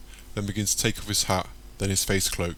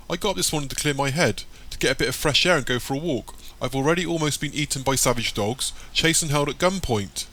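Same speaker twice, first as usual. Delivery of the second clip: agitated; 6 semitones higher than usual. A narrator's reading. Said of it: "Michael, shocked, shouts".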